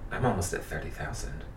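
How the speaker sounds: very low, soft retold self-talk; hushed, low pep talk to oneself; quiet self-talk. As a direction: murmur it to yourself, pitched right down. Retold late-night self-talk before outburst.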